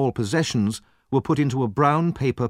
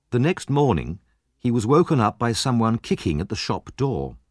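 plain reading voice

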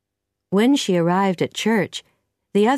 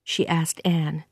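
ordinary delivery

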